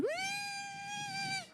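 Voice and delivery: thin comic squeal, drawn out; mock high squeal. Squeal on the scrimmage line.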